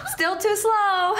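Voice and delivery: high, slow laughing taunt; laughing tease. Acted. Big sister taunting her little sister, laughing. Teasing sister after dodging.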